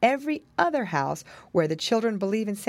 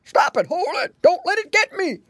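put-on slurred old pig in a panic; loud, jumpy grandfather yelps; hammed-up fright in an elderly pig voice; alarmed cry; 11 semitones higher, 6 dB louder than usual. A narrator's reading. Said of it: "cried the old gentleman pig".

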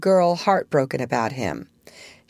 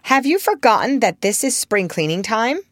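high, mildly reproving question; raised-pitch, lightly scolding boy; a little stern; 6 semitones higher, 3 dB louder than usual. A narrator's reading. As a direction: remind her with a touch of sternness. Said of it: "he added a little sternly".

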